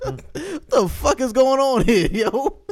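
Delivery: real laughing disbelief at the mic mishap. Real, not acted. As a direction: laugh through the outrage. Laughing at mic hitting lips.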